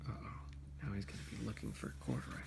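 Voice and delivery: hushed suspense whisper, fast. Real, not acted. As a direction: whisper it like a secret so the watchman won't hear. Suspense aside to child.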